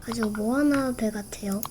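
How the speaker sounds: softly whispered aside. Real, not acted. Streamer whispers the boat comparison to viewers as an aside. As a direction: whisper it like a secret.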